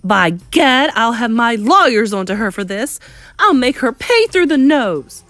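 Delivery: loud, high blustering threat; angry shout. Acted. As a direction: bluster furiously, vowing revenge. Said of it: "shouted Mister Jenkins".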